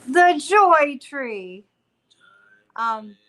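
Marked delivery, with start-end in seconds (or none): 0.1-1.7 loud, higher, drawn-out announcement; slow, ringing playful call; playful proclamation. Real, not acted. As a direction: proclaim it grandly, stretching each word. Playfully proclaims name of tree.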